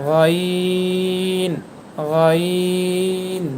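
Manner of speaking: গইন drawn out from the upper throat; Arabic letter demo. Shown as a demonstration. Teacher drilling গইন for students. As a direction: gargle গইন slowly. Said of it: "same জায়গা থেকে গইন হরফটি".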